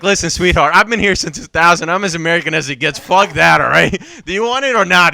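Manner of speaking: loud, pushy retold comeback; mock brash outburst. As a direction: drop the act and get forceful. Retold blunt dropping of the act.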